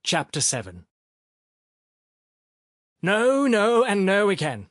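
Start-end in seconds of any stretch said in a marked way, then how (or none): 3.0-4.7 high, loud, emphatic refusal; insistent. A narrator's reading. Baumenthal refusing Sharikov, insistent. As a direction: refuse firmly, raised in pitch and volume. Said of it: "Baumenthal said insistently".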